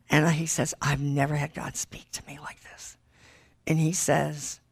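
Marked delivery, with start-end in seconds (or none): 0.8-3.0 whispered, hushed and awed; a husband's stunned whisper. Retold or imitated. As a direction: breathe it out in a whisper.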